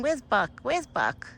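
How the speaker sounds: ordinary delivery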